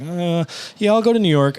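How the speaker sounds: high, hedging retold yes; reluctant agreement. Reluctantly agreeing, still nervous.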